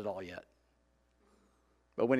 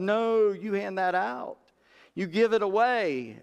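slow firm command; 6 semitones higher, slower than usual. Retold or imitated. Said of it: "Jesus answers disciples".

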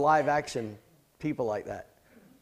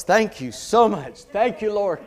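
higher, slower grateful thank-you; relieved thanks; 6 semitones higher, 5 dB louder than usual. Real, not acted. Preacher thanking the congregation and the Lord, relieved and higher. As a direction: sigh out real relief. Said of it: "I can sleep tonight".

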